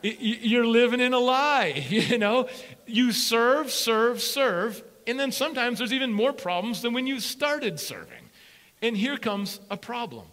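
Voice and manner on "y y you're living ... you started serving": loud, high preaching build. Acted. Preacher pressing the church on serving. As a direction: preach it hard to the congregation.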